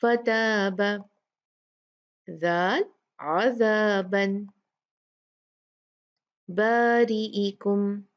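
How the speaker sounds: फताबा and अज़ाबन drawn out slowly with thin alif; slow thin recitation. Shown as a demonstration. Teacher drills students on thin-letter words. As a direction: recite each slowly and fine. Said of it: "ता भी बारीक हरूफ है".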